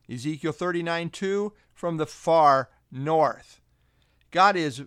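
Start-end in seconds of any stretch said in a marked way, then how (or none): 1.7-3.6 very slow "from the far north", each word weighted; slow, emphatic "far north"; stress on far north. Shown as a demonstration. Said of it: "note the strong emphasis on north".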